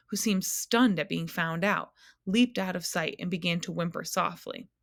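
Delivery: ordinary delivery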